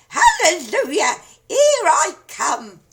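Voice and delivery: put-on high, loud, slow triumphant old crone cry with a laugh; gleeful shout. A narrator's reading. Grandma rising through the house, gleeful shout. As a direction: crow it out in triumph. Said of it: "she shouted".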